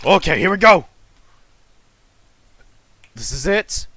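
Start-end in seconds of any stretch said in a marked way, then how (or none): 0.0-0.9 loud, high launch cry; keyed-up yell; psyched up. Real, not acted. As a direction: shout it as the big moment starts. Psyching up for the climax.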